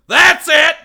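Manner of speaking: very loud, high angry shout; acted-out fed-up yell; imitated furious owner. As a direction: explode like you've had enough. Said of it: "The guy goes".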